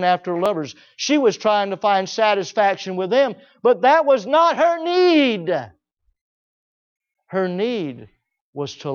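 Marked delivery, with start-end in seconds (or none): 3.6-5.5 raised, drawn-out cry on the point; slow, high-pitched insistence; emphatic preaching. Real, not acted. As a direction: hammer it home, stretching the last word. Preacher drives home key point.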